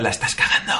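conscience whispering in the ear; Jiminy Cricket whisper. Pretend. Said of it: "como Pepillo, Pepito Grillo, en plan".

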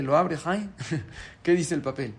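usual speaking voice

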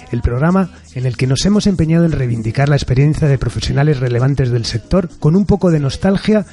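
ordinary talk

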